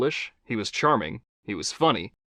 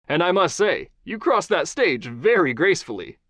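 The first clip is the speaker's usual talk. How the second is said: higher, upbeat host voice; talk-show host Johnny; 7 semitones higher, 4 dB louder than usual. Acted. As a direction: charm the guest as a TV host. Johnny teasing.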